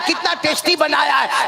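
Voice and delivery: high-pitched admiring exclamation; gushing food praise. Acted. Husband keeps praising each mouthful, gushing. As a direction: lay the compliment on thick.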